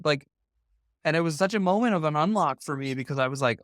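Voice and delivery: ordinary delivery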